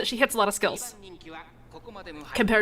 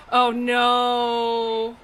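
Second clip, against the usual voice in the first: dismayed; 5 semitones higher, much slower than usual. Real, not acted. Race lost.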